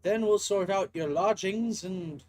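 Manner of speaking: brisk, helpful English professor; kindly organizing game professor. Acted. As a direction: take charge in a lilting academic voice. Voices the game's professor.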